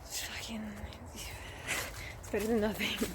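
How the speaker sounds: hushed whisper so the passerby won't hear; slow whispered aside. Real, not acted. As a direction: drop to a breathy whisper. Passerby with dog nearby.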